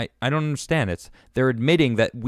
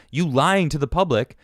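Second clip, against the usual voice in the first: usual speaking voice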